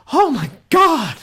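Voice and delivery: high, loud, slow shocked cry; child's shocked shout. Retold or imitated. Youngest son seeing dad shaved, high shocked shout. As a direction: shout it like a stunned little kid. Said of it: "my littlest son was like".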